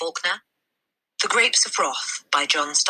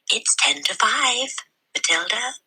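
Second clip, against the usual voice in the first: gentle reminder; 7 semitones higher than usual. A narrator's reading. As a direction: call her kindly from across the room. Librarian calls closing time.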